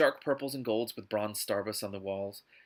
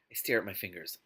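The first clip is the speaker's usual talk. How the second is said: usual speaking voice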